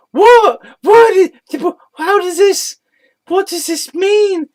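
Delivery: shrieking what in disbelief; stunned high-pitched outburst; incredulous shock. Real, not acted. Shocked by absurd claim.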